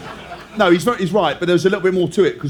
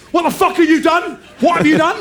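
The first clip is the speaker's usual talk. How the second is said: much higher, hammed-up yell of a coach; furious coach shout; 11 semitones higher than usual. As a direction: storm over and demand answers. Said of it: "comes marching over and goes".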